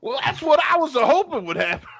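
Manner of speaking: Bill Cosby impression. Gloating as the victim eats the pudding, high and smug. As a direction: savor the plan working. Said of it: "my Bill Cosby impression".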